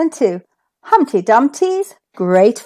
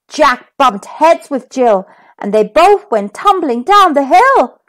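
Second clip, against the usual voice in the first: usual speaking voice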